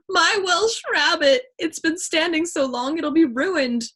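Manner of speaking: high, slow wailing cry; mock-tragic wail over the food; loud wail. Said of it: "a loud wail from Chet".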